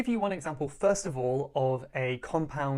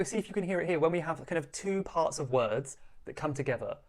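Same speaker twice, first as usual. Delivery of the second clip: normal talking voice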